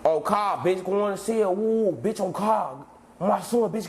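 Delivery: usual speaking voice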